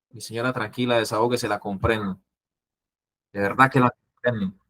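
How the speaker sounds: soft, low reassuring voice; quiet calming tone for an upset lady; calm soothing. Shown as a demonstration. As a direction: soothe a crying woman gently. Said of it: "el tono de voz debe ser condescendiente".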